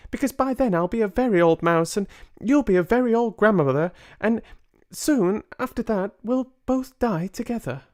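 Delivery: put-on high, quick small boy's voice; a little mouse boy chattering fast; young boy mouse. Boy mouse tells grandmother of their future, high and quick.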